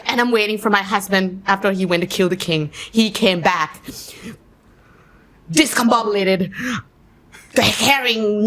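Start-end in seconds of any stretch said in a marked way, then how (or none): none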